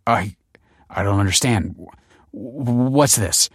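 halting stammer rising into alarm. A narrator's reading. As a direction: stumble over the first words, then demand urgently. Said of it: "stammered Michael".

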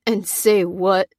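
slow, croaky reply; slow, broken-throated question; croaking. A narrator's reading. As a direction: croak it out in grief. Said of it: "croaked Pete".

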